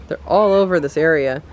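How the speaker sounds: ordinary delivery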